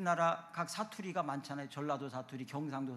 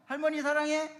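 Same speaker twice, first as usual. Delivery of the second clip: imitating small child; 9 semitones higher, 8 dB louder than usual. Child's words to grandma.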